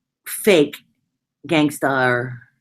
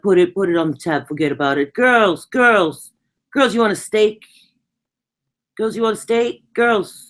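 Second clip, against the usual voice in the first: higher, louder mock Brooklyn wiseguy calling the girls; fake Brooklyn gangster; 5 semitones higher, 4 dB louder than usual. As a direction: ham up a fake Brooklyn tough guy shouting "Girls". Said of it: "he talked like he was from Brooklyn".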